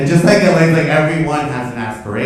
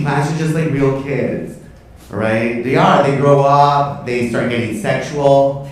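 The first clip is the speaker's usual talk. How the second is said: normal talking voice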